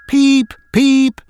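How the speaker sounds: high, loud peeping whistle; sharp train whistle peeps; impatient whistle. A narrator's reading. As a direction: toot it out impatiently like a little engine. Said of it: "he whistled impatiently".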